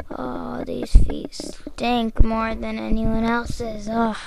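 ordinary talk, nothing marked

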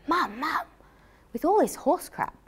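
put-on squeaky delighted kid calling his mum; excited child.

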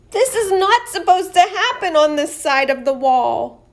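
high, loud indignant protest; alarmed knight. Acted. Knight upset the wall failed, high loud protest. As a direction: complain something is wrong.

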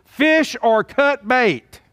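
high, slow father's ultimatum; daddy's saying. Retold or imitated. Preacher quotes his daddy's saying, slow fatherly ultimatum. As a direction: draw out each word like a dad laying down the law. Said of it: "as my daddy would say".

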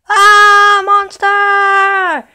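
high, loud panicked shout; scared scream. Acted. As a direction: shriek at the sight of a monster. Luigi startled by the dinosaur.

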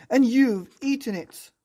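high, loud furious yell; angry shout. Acted. Marvin furiously yelling at Molly for eating his apple. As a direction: blow up at her. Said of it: "shouted Marvin".

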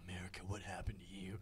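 low breathy whisper, almost unvoiced. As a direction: hush it like a secret. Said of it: "Is that [name] talking?".

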